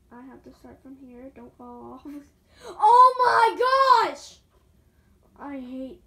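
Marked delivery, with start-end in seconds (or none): 2.7-4.4 mock anguished wail. Falling off again.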